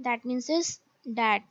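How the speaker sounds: ordinary talk, nothing marked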